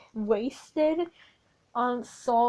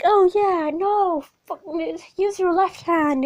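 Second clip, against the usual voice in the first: dumb jock voice; 6 semitones higher, 6 dB louder than usual. Retold or imitated. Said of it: "He's like".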